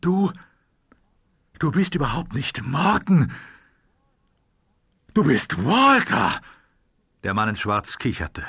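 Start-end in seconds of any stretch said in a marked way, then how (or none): 0.0-6.4 high, raised voice of disbelief; stunned accusation, rising in volume; shocked realization. A narrator's reading. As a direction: blurt it out in dawning recognition. Shocked recognition of the disguised enemy.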